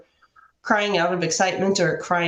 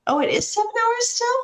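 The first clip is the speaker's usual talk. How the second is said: surprised. Real, not acted. Surprised at the flight length.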